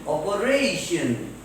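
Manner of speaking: "operation" slowly, with RA drawn out; stressed syllable before -tion. Shown as a demonstration. Teacher giving another example, slow stress on RA in "operation". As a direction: stretch the RA in "operation". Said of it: "should be pronounced loudly".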